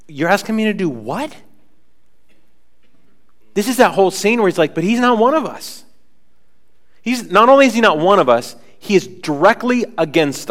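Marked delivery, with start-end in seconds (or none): 0.1-1.3 higher, disbelieving question; can't believe what's being asked; incredulous. Retold or imitated. Ananias questions the Lord's order, incredulous. 4.7-5.8 high, alarmed protest; alarmed objection. Retold or imitated. Ananias objects to going to Saul, alarmed.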